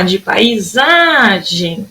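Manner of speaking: "paisagem" with a long held "sa"; slow "paisagem", stress sung out on "sa"; stretched stressed syllable. Shown as a demonstration. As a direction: draw out the "sa" in "paisagem". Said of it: "a sílaba que mais durou tempo".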